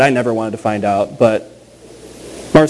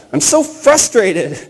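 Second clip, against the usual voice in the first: slow, higher mock-frustrated cry; blurted out; 7 semitones higher, much slower than usual. Pastor to his wife in the living room, mock frustration. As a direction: blurt it out in a hammed-up sigh. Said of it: "I just blurted out".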